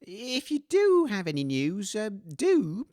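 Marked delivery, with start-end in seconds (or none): none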